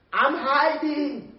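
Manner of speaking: loud, high, drawn-out reply as Adam; Adam answering. Acted. Adam replies to God from hiding, calling back slowly. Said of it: "And Adam replied".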